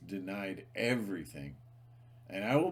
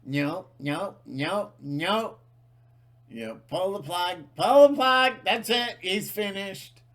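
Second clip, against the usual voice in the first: much higher, loud frantic censor voice; mock YouTube censors; 11 semitones higher, 11 dB louder than usual. Mocking YouTube staff panicking to pull the plug, high loud shriek. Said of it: "I forget YouTube. They'll just".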